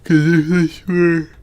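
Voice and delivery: high, loud yawn over the words. Real, not acted. Gamer reasoning about the build, overtaken by yawning. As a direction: let a yawn swallow the sentence.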